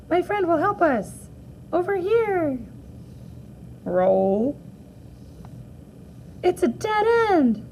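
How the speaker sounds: high, childlike cartoon voice; lightly voiced cartoon character. Characters in her short film calling out. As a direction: squeak it like a toy hero.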